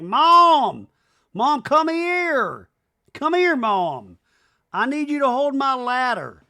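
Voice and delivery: high, loud call across the house. Retold or imitated. Brittany calling her mom to come hold the ladder, loud high shout.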